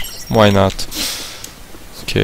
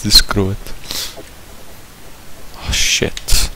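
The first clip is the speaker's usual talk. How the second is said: whispered curse; 5 semitones lower, 10 dB quieter, slower than usual. Real, not acted. Sneaking in game.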